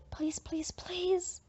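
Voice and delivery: whispered begging please; hushed eager pleading. Acted. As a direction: beg under your breath, pleading. Begging dad for later curfew.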